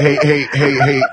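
laughing interjection; real amused "hey, hey". Real, not acted. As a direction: break in through laughter. Host laughs while breaking in.

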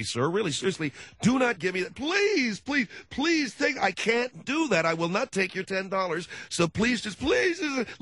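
high, pleading mock refusal with rising pitch. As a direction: beg him to keep his money, then bargain. Refusing the ten bucks.

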